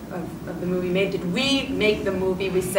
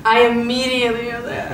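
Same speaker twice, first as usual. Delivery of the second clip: bursting into a high, loud laugh; 4 semitones higher, 5 dB louder than usual. Real, not acted. Laughing, overcome at screening.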